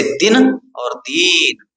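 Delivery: slow demo contrasting दिन with a short i and दीन with a long ee; short and long vowel. Shown as a demonstration. Teacher showing students the difference, slow careful demo. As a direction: draw out the ee in दीन, keep the i in दिन clipped. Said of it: "फर्क है".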